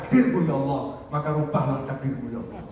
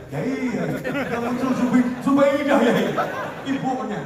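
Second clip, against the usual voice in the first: higher, louder, laughing through it; embarrassed young man laughing at his answer; sheepish laughing report to the teacher; laughing confession; 7 semitones higher, 4 dB louder than usual. Retold or imitated. Sulaiman reports result, laughing.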